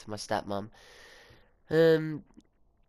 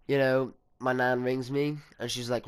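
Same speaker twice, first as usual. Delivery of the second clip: ordinary talk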